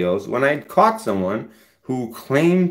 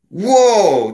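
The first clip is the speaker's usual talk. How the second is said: sudden high, loud whoa; startled by the screen; surprised; 10 semitones higher, 6 dB louder than usual. Real, not acted. Reacting to a pop-up on his screen, startled.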